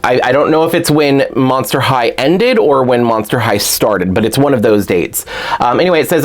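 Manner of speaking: ordinary delivery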